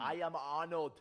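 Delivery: loud, thick mock Austrian accent; Arnold. Trying German accent for co-host, loud bad celebrity impression. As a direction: boom out a badly garbled strongman accent. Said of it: "sounded like I just have a s-, terrible speech impediment".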